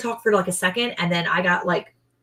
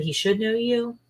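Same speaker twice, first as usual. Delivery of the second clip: ordinary delivery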